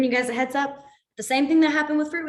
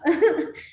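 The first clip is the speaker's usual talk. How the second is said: short laugh; 6 semitones higher, 5 dB louder than usual. Real, not acted. Awkward signing laugh.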